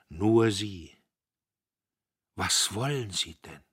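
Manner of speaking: a father fighting tears; holding back sobs. Acted. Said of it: "das Schluchzen unterdrücken". (unterdrücktes Schluchzen, leise)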